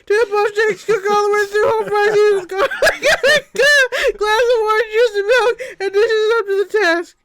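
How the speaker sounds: high, wailing, tearful preacher crying to heaven; screaming preacher prayer. Acted. The Reverend Dumbledore praying for breakfast, in a high crying scream. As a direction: beg the Lord for breakfast in a high sobbing wail. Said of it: "raised his hands skyward and screamed".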